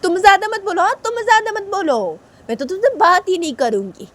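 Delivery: high, huffy comeback; cross retort. Acted. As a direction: snap back at your brother. Uma retorts in quarrel.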